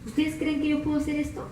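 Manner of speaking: soft, calm question; calm and gentle. Acted. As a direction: ask gently, quietly. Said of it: "bien calmadito le dice el Señor Jesús". (pregunta tranquila y suave)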